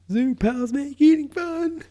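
high, loud ad announcer; commercial jingle voice. Retold or imitated. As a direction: belt it like a kids' TV ad. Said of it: "it's the same shit".

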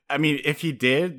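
normal talking voice

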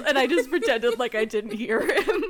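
loud, high, laughing through the words; can barely get it out for laughing. Real, not acted. Host cracking up telling her cohost the story, laughing.

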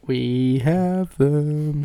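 very slow, every word stretched; drawn-out mock emphasis. Co-host plugs their social media, drawn-out mock emphasis. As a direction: stretch out "We have them" word by word, jokingly. Drags out the repeated line jokingly.

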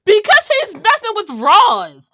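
high, loud and slow outrage; indignant. Real, not acted. Fan outraged at the cheating boyfriend, loud. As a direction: spit it out in disbelief at the cheater.

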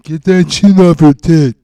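slow, loud New Jersey gangster bark; loud mobster voice. Pretend. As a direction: bark it like a mob boss. Sopranos impression, loud.

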